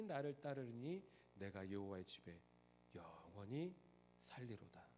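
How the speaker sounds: soft, low, slow recitation of Psalm 23; an old man reciting with eyes closed, hushed and sincere; quiet, devout psalm reading; slow reverent recital. A narrator's reading. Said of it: "지그시 눈을 감고 천천히 낭송하기 시작합니다".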